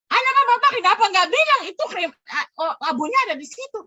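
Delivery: fast, high and loud scolding; angry complaint. Retold or imitated. Mother scolds the cremation staff with fast, high indignation. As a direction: demand answers, voice climbing in outrage.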